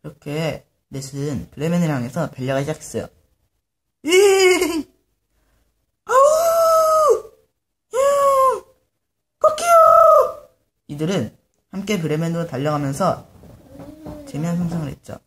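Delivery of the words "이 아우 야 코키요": very high, drawn-out braying, howling and crowing; four animals calling out together; animal cries. Acted. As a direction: let each animal howl out long. Animals cry out as they run.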